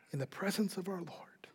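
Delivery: near-whispered, reverent close; hushed reverence. Real, not acted. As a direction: drop almost to a whisper in awe.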